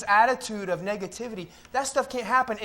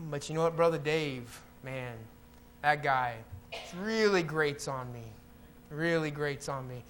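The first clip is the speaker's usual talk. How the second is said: low, softer aside of irritation; mock confidential griping; 7 semitones lower, 7 dB quieter than usual. Churchgoer griping about Brother Dave, low and confidential. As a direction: drop way down as if griping behind someone's back.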